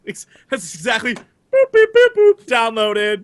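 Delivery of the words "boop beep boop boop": high electronic beep-boop sounds; beep boop noises. Retold or imitated. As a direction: imitate a gadget beeping. Imitating phone download beeps.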